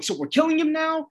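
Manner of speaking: mock Dove voice. Recapping Dove's reaction to Hank, mock high voice. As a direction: squeak out the question in disbelief. Dove's shocked question, put-on voice.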